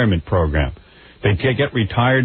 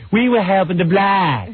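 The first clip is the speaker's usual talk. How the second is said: slow, high Boston accent impression; 8 semitones higher than usual. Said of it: "with the Boston accent".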